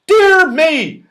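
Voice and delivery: very high, loud baffled squeak; surprised saucepan man. Acted. Saucepan man puzzled by the toffee shock, high surprised squeak. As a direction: mishear it in high confusion. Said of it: "even more surprised".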